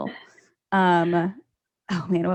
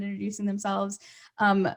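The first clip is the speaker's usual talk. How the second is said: ordinary talk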